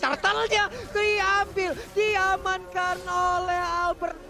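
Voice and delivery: high, slow, drawn-out hype; hyped caster call. Real, not acted. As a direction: stretch it out like a stolen objective. Caster hyping turtle steal.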